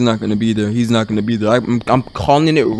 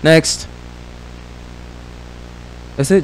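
usual speaking voice

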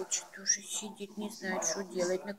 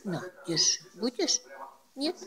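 usual speaking voice

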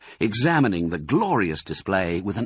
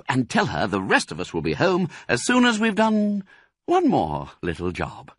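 high, lively instructions; fatherly, bustling fox; 8 semitones higher than usual. Acted. Fox sends two cubs to their mother, bustling. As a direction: send the children off with a message.

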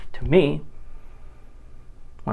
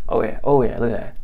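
normal talking voice

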